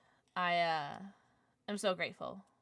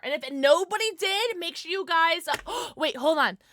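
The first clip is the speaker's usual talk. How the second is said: excited hype; 6 semitones higher, 8 dB louder than usual. Real, not acted. Hyping chat then interrupting.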